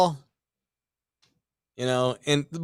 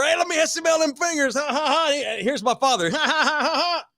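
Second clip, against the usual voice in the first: high, giggly dad impression; hammed-up clowning father; joking dad; 11 semitones higher than usual. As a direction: tease and laugh at your own gag. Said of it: "he just thinks he's being funny".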